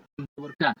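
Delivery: ordinary delivery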